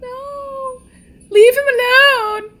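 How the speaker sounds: slow loud high plea; begging shout. Real, not acted. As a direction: yell at the screen. Yelling at movie character.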